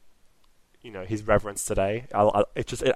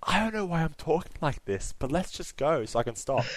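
soft, higher breathy ASMR voice; 4 semitones higher, 6 dB quieter than usual. Real, not acted. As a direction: murmur like an ASMR video. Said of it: "doing all A S M R on us".

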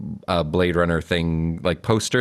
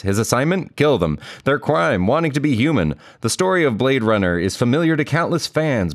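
trailer voice read; 4 semitones higher than usual. Acted. Reads DVD back cover.

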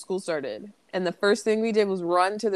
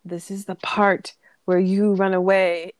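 quoting Shrek; 6 dB louder than usual. Retold or imitated. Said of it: "the quote is".

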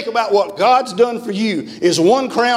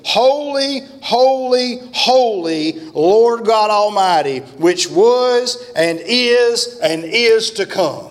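high, slow, ringing proclamation of holy; soaring preacher's cry; fervent preaching; 4 semitones higher, much slower than usual. Acted. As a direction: lift it up slowly like a revival preacher crying out. Preaching scripture at full force.

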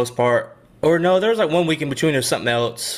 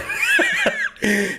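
high laugh burst. Real, not acted. Laughs at childhood memory.

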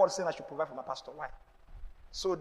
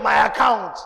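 louder, shout the words back; shouted repeat; 10 dB louder, slower than usual. Shown as a demonstration. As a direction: push the congregation to say it louder. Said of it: "Say it again".

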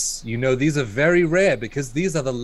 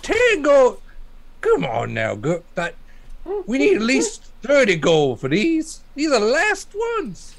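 yokel haggling; 10 semitones higher than usual. Acted. Yokel to merchant, high haggling protest. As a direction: bargain like an outraged yokel. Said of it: "A yokel".

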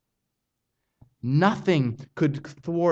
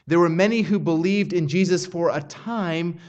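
usual speaking voice

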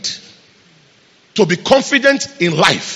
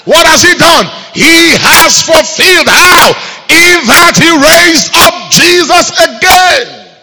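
shouted high and slow, rising to a climax; preaching at full force; 9 semitones higher, 7 dB louder, slower than usual. Real, not acted. Preacher shouting the resurrection to the church, full force. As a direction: proclaim it like a victory cry.

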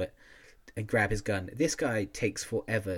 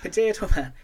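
excited repeat; 9 semitones higher, 7 dB louder than usual. Real, not acted. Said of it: "repeating Potato Man for laughs".

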